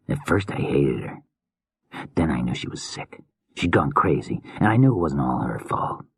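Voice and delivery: soft broken whisper; hushed, wounded madman; whispering grieving killer. Acted. Killer grieving lost wife.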